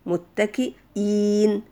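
متكئين stretched very slowly with a long ii; متكئين slowly, syllable by syllable; drawn-out demo. Shown as a demonstration. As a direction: draw out the ii in متكئين. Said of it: "زي مثلًا".

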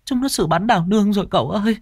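high, fast tearful whimper; sniffling servant girl. A narrator's reading. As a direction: snivel out the news. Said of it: "Tư Hiền thút thít".

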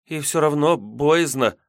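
put-on slow, uneasy girl's admission; timid girl voice. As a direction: confess it like a shivering girl. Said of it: "поёжившись, призналась паломница".

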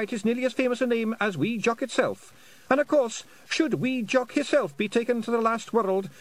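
high squeaky Scottish pixie; tiny Scots warrior, chirpy and high; Feegle voice. Acted. Feegle explains his name.

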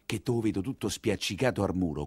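ordinary talk, nothing marked

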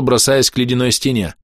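plain reading voice

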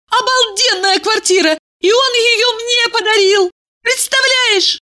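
put-on high squealing girly voice; bubbly sorority girl. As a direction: brag it breathlessly happy. Valeria boasting on phone.

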